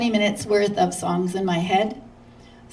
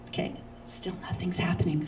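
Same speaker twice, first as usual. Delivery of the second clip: softer whispered aside to herself; 9 dB quieter than usual. Retold or imitated. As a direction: breathe it out, not for the audience.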